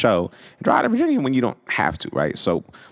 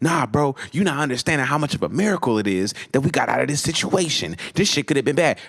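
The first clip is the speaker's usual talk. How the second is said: high-pitched, worked-up imitation; insistent protest; 7 semitones higher than usual. Brian arguing with Lawrence about the miracle, high insistent protest. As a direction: press the point like an excited friend.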